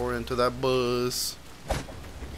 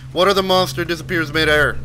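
usual speaking voice

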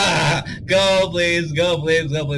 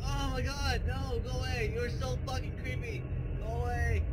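scared whimpering; 8 semitones higher, 8 dB quieter than usual. Real, not acted. Creepy figure approaching, pleading.

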